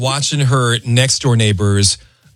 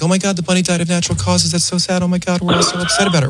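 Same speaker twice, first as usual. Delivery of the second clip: fake sadness; 6 semitones higher, faster than usual. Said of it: "just pretend like".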